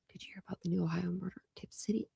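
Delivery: breathy whispered read of a comment. Real, not acted. Streamer whispering a viewer's question to the chat.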